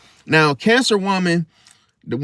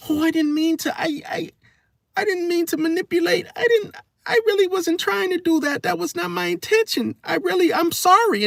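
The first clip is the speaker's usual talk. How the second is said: mock crying. Very high mock sobbing apology to a lover she's deceiving. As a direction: fake tears while pleading. Tears falling down their face.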